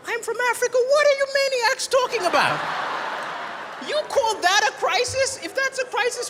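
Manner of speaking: high, loud incredulous outburst; outraged rant. Acted. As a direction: shout it at the crisis-whiners.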